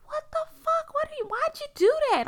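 very high, wailed disbelief; high-pitched, distraught outcry; retold crying protest. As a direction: act out a tearful demand to know why. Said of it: "I'm crying".

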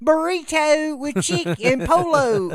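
very high, loud, slow mock Spanish. Retelling ordering in bad Spanish, high loud accent. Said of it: "doing my little tiny redneck Spanish".

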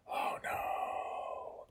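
unvoiced, soft groan of dismay; whispered dismay. Father watching daughter knock on the door, whispered dismay. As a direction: breathe it out in despair.

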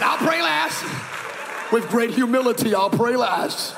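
mock humble shout. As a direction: proclaim it grandly, then draw it out. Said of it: "declares with mock humility".

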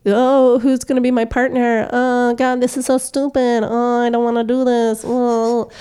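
high, loud mock whine of bored students; a put-on whiny voice; whiny classmates. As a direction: whine like a sulky teen. Classmates whining about partners.

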